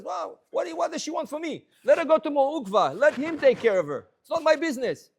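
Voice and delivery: high, loud, brushing her off; dismissive. Acted. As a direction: wave away the problem with a loud what. Voicing the dismissive Rav.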